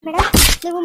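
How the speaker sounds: loud, urgent call to the madam; urgent shout. Acted. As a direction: shout over the fight to take the child. Constable shouts during scuffle. (ಜೋರಾಗಿ ತುರ್ತು ಕೂಗು)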